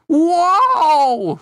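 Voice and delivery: long, loud, high drawn-out whoa; big cartoon amazement, held and falling off; awestruck whoa. A narrator's reading. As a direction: exclaim it high and stretch it out. Larry awestruck at the leek girl.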